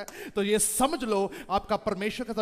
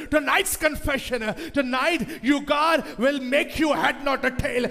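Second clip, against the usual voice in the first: slow repeated refrain, rising louder and higher; building preaching; 7 semitones higher, 6 dB louder, much slower than usual. Acted. Preaching building to full force.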